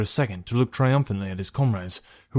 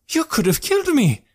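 high-pitched outraged yell; sudden shouting; 4 dB louder than usual. A narrator's reading. Traveller to the archer who risked his life, shouting. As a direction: burst out in a high, raised voice. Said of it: "Jekrom raised his voice, suddenly shouting".

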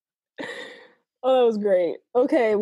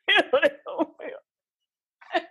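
laughing ew; 6 semitones higher than usual. Real, not acted. Disgust while laughing.

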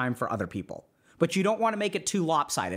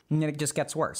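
usual speaking voice

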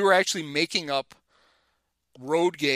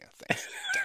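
much higher, mock-sweet sarcastic thanks; sarcastic reply to the ex-pro who played dumb at darts; 6 dB quieter than usual. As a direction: thank him sweetly while meaning the opposite.

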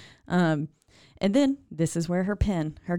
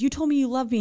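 higher, pleading as Edith; wounded accusation; 8 semitones higher, faster than usual. Retold or imitated. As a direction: throw his words back at him, hurt. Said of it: "And then she says".

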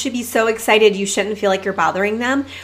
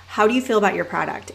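ordinary delivery

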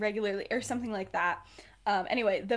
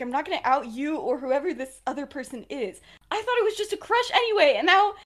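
agitated retold protest, higher and louder; worked up; 5 semitones higher, 7 dB louder than usual. Regulus confronting Sirius about telling others, worked up. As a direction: act out someone getting worked up, speeding up. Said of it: "he's a little worked up".

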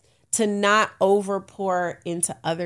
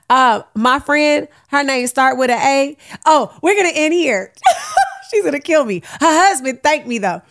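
higher, louder, bubbling with laughter; excited giggling; 8 semitones higher, 10 dB louder than usual. Real, not acted. Telling listeners about her friend, excited and laughing. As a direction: spill the gossip, barely holding the laugh.

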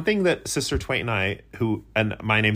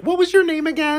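much higher, louder sheepish ask; high awkward voice; 5 dB louder than usual. Pretend. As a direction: squeak it out sheepishly. Acted awkward question.

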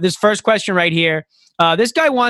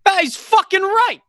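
very high, fired-up mock agreement; over-the-top pretend endorsement; riled-up audience man; 14 semitones higher than usual. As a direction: yelp your approval like a convinced heckler. Voicing men in the room agreeing.

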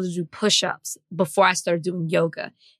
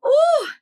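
high, louder mock crying "ooh"; a hammed-up tearful coo; imitated happy sob; 5 dB louder than usual. Retelling [name] crying from joy, mock sob. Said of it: "She's like".

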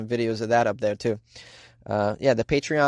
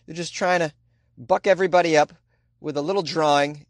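ordinary delivery

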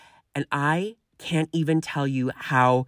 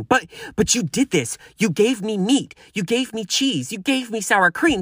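higher, mock whiny customer voice; whiny repeating customer; 7 semitones higher than usual. Customer nags waiter, higher mock-whiny voice. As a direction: repeat each grievance like a sulky diner.

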